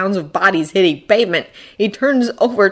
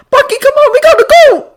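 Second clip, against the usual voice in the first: very high, loud panicked urging; frantic high shout; desperate urging; 8 dB louder, faster than usual. A narrator's reading. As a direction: urge him frantically to move. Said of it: "A voice, a desperate".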